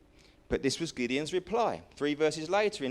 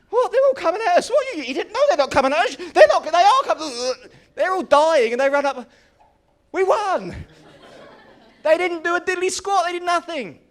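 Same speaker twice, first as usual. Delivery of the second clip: much louder and higher, fast and laughing; frantic confusion; 11 semitones higher, 12 dB louder than usual. Acted. Preacher acting the confused Midianites, loud and laughing. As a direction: play the panicking enemy.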